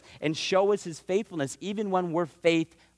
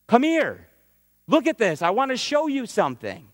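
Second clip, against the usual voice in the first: higher, firm, urgent divine summons; God calling Abram; 5 semitones higher than usual. Acted. Higher urgent voice as God calls Abram apart. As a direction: call him aside, insistent and earnest.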